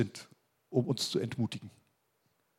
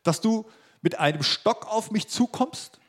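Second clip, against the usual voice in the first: higher, slow, mildly mock-indignant question; Goliath's challenge; 7 semitones higher, slower than usual. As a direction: draw out the affronted challenge, pitch lifted. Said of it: "rief er David zu".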